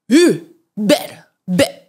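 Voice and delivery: mock scolding in short bursts; imitated scolding. Japanese uncle to tourist taking photos, imitated scolding. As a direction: imitate an old uncle telling someone off. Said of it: "The uncle that was scolding me".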